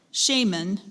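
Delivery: "shaman" as SHAY-mun, first vowel as in "day"; second alternate pronunciation. Shown as a demonstration. Pronouncer offers the speller alternate pronunciations, "shaman" as SHAY-mun. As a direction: say "shaman" with a long A, stressed first syllable. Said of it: "a couple alternate pronunciations".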